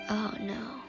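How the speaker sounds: ordinary talk, nothing marked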